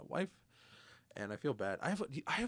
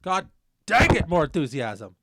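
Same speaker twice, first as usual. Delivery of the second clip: mock frustrated yell; 6 semitones higher, 19 dB louder, slower than usual.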